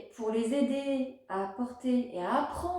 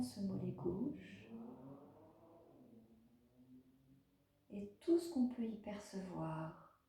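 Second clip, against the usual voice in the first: guided meditation; 4 dB quieter than usual. Acted. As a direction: guide a child gently through a body scan, unhurried.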